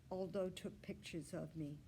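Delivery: usual speaking voice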